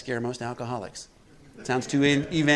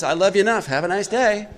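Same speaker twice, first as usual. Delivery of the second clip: a cheery God, higher and louder, laughing through it; mock God voice; 6 semitones higher, 6 dB louder than usual. God cheerfully sending a wandering drinker off. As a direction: wave them off with a breezy laugh.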